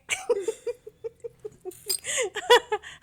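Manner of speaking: long high cackling laugh. Real, not acted. Cracking up at her own joke.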